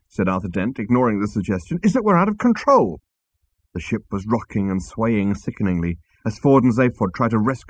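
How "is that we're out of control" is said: high-pitched alarmed conclusion. Acted. Arthur telling the crew the ship is lost, alarmed. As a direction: voice climbing in alarm.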